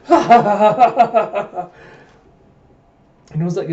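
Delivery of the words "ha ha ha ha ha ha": loud, high burst of ha-ha laughter; acted guffaw; mocking laugh. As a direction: laugh out loud at someone's troubles. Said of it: "Huck's like".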